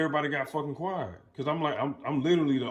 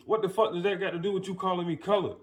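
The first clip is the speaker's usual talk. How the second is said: higher, fast retold indignation; fast mock-outraged question; indignant challenge; 7 semitones higher, faster than usual. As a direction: act out an offended demand for explanation. Retells his angry challenge to stranger.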